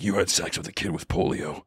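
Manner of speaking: low, quiet deadpan accusation; low menacing Walter. Retold or imitated. Walter accusing the girl, low and quiet. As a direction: say it low and cold.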